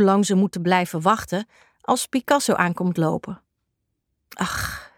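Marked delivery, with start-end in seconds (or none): 4.3-4.9 breathy, voiceless sigh of grief; quiet mournful ach; sorrowful sigh. A narrator's reading. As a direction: let the word fall out soft as a sigh. Picasso mourns the dead cat.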